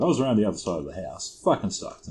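usual speaking voice

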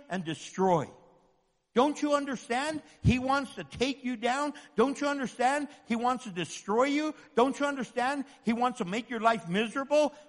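ordinary talk, nothing marked

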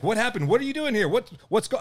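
high, urgent mock questions; high-pitched retold bewilderment; imitated alarmed questioning. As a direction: imitate a startled man firing off questions. Imitates uncle calling out, alarmed.

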